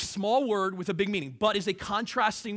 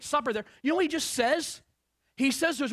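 usual speaking voice

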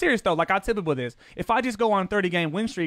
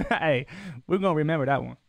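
normal talking voice

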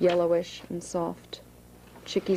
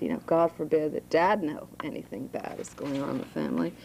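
plain reading voice

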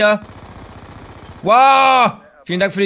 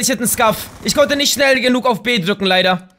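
normal talking voice

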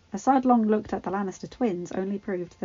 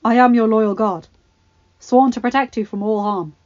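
loud, raised earnest protest; loyal knight; 2 semitones higher, 11 dB louder than usual. Acted. Jaime protesting to Joffrey, earnest. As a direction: plead your loyalty.

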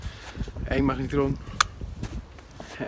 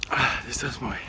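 ordinary delivery

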